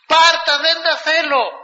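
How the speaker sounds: high, loud, urgent plea; high, loud call after the boat; desperate plea. Retold or imitated. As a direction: shove the money back at her, calling after her. Man runs after her to return money.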